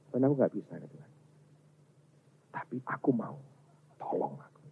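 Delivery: softly confessing helplessness in prayer; honest quiet plea. Acted. Believer honestly admitting weakness to God, soft pleading. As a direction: drop to a near-whispered plea for help. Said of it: "Kenapa nggak jujur aja?".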